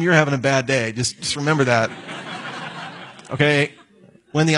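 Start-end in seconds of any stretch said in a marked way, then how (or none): 2.0-2.9 short quiet laugh. Real, not acted. Preacher laughs at his own point.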